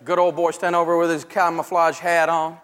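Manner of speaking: ordinary talk, nothing marked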